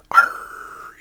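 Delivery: forceful pirate growl in a whisper; loud stage-whispered pirate cry. Retold or imitated. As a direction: imitate a pirate's drawn-out "Arr". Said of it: "He always talked like a pirate, like".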